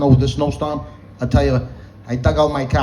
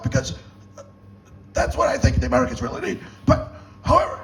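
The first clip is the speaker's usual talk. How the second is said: strained, high-pitched, halting croak; RFK Junior. Acted. Comic telling a cop they can't breathe, as RFK Junior. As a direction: squeeze the words out high and choked, in stutters. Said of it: "I sounded like R F K Junior".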